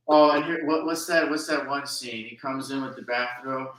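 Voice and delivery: ordinary delivery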